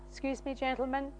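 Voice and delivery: very soft, contained excuse; hushed, controlled retelling; restrained politeness. Retold or imitated. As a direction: excuse yourself quietly, holding it in. Retold polite exit while upset.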